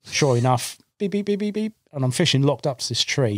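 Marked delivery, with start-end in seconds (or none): 1.0-1.7 high electronic beep-beep imitation; rapid high beeps like a bite alarm. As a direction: mimic a fishing bite alarm beeping.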